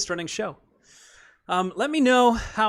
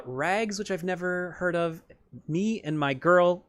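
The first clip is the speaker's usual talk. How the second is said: ordinary talk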